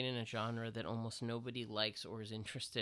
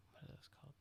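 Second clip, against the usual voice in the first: whispered aside; 18 dB quieter, much faster than usual. Real, not acted. Whispered aside while searching word.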